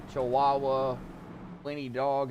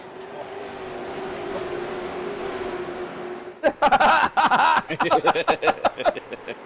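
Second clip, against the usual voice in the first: high laughing fit; long laughing fit; 13 semitones higher, 4 dB louder than usual. Real, not acted.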